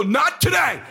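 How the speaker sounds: high, intense rallying cry; fired-up pep talk. Retold or imitated. Dad rallying son before golf, fired-up high-pitched urging. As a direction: pump him up at full intensity.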